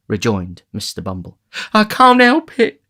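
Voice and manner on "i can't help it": high, loud, drawn-out whimper. A narrator's reading. Matron to the beadle in distress, whimpering. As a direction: wail it slowly in a high lady's voice. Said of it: "whimpered the lady".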